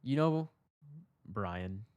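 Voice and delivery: measured, paused lead-in after a sigh; sighing patience. Retold or imitated. Teller re-enacting his sighed reply to Brian, sighing patience. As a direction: set it up slowly, like a sighed confession. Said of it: "take a deep breath and I sigh and I say".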